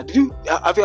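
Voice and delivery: ordinary talk, nothing marked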